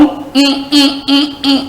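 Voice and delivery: short, even high-pitched hums; placeholder hum. Shown as a demonstration. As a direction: hum the blank in steady high beats. Hums to stand for the blank.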